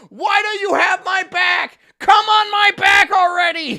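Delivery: furious high shout, slow and drawn; full-throated gaming rage yell; screaming. Retold or imitated. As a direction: yell at teammates to push. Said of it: "I'm constantly screaming at these two".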